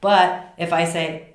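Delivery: ordinary talk, nothing marked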